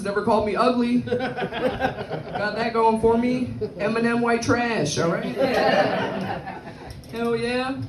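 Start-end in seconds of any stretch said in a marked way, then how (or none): none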